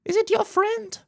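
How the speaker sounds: very high squeaky voice; Paimon voice. Acted. Streamer voicing Paimon asking the hero, very high. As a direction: ask it up high and chirpy. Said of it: "that shitty-ass voice for Paimon".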